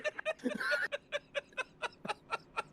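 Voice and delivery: long helpless high giggle; laughing fit. Real, not acted. Laughing fit over Steven's remark.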